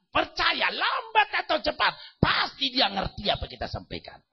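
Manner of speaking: high, loud exhorting preach; preaching at full force. Acted. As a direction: drive it home to the congregation. (khotbah berapi-api, keras dan tinggi)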